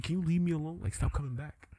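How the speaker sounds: soft, mock-pitiful begging; weary plea. Dormammu begging Strange to leave, softly worn out. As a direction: drop to a small, quiet plea.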